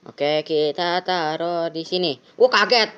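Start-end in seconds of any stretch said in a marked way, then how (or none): 2.4-2.9 sudden higher, louder startled gasp of words; jump scare. Real, not acted. Player startled by the police officer, sudden startled cry. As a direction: blurt it out as the scare hits.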